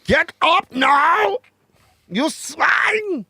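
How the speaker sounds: high, slow barked German officer voice; mock German accent. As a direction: shout it like a cartoon German officer. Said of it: "Mine would be German".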